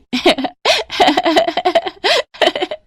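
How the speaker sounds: high mocking giggles; a room of kids laughing; class laughter.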